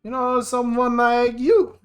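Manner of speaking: put-on worried big brother with a German accent. Brother worrying over his sister, in a German accent. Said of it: "Why am I German?".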